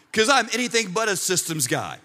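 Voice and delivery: normal talking voice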